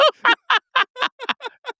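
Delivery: very high squealing laughter; rapid shrieking practice laugh; high giggling fit. Shown as a demonstration. Laughter exercise continues.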